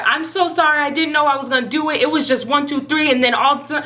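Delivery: high-pitched, hurried excuse; flustered apology. Retold or imitated. Acting out flustered apology to sister.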